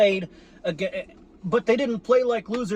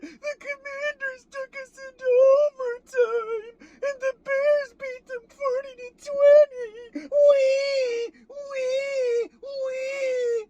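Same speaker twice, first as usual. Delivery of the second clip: very high, whiny fake sobbing; hammed-up wailing of a spoiled crybaby; squealing mock cry; mock crybaby fan; slower than usual. Mocking fans who whine online.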